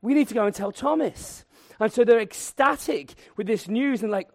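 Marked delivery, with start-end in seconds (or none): 0.0-1.5 eager disciples. Retold or imitated. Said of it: "they're like".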